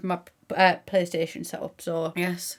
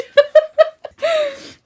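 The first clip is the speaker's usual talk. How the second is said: a loud, high laugh bursting out; sudden loud high laugh; high burst of laughter; 11 dB louder than usual. Real, not acted. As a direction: crack up at your own joke. Bursts out laughing after joke.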